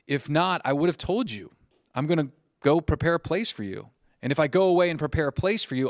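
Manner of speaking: projected, emphatic Bible reading; scripture reading. Acted. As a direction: proclaim the verse to the room. Reads scripture aloud.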